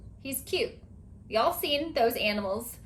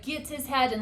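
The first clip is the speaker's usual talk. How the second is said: ordinary talk, nothing marked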